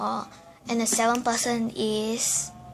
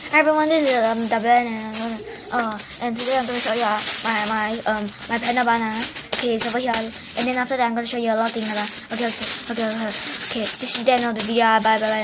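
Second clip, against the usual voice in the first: rushed, run-together chatter; talking very fast; 4 dB louder, much faster than usual. Acted. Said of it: "annoying person ever that talks very, very fast".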